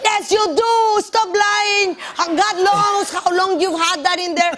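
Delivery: high, pushy mock husband voice; husband insisting. Husband scolding wife about the hidden duck, mock insistent. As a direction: accuse her of lying, hammed up.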